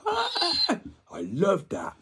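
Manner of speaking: high slow gleeful laugh into words; giddy cackling delight; laughing relish. Real, not acted. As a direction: savour it with a laugh.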